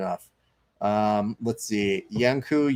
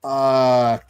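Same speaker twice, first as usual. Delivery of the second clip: retold excited curse; 3 semitones higher, 7 dB louder than usual. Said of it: "him and his wife go".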